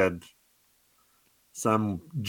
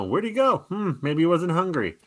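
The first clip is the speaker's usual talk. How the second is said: higher-pitched musing to oneself; puzzled film character; 6 semitones higher than usual. Retold or imitated. Retelling her coming back out, puzzled higher voice. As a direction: lightly imitate a puzzled woman. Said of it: "she does come back out and go".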